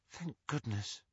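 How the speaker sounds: hushed thanks; whispered. Acted. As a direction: whisper it in relief. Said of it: "Ginny whispered".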